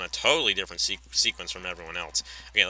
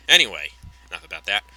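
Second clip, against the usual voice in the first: normal talking voice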